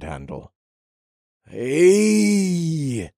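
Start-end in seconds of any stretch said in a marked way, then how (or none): 1.5-3.1 put-on high, drawn-out drunken holler; tipsy man calling over; slurred drunk call. A narrator's reading. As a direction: slur it out long like a drunk. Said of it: "the lean blonde man slurred".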